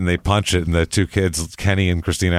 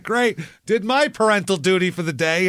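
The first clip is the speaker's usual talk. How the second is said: mock mom; 9 semitones higher than usual. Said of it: "She's like".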